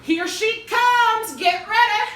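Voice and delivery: high, loud shout from a crowd. Acted. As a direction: yell a warning to the mob. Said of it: "I heard someone shout".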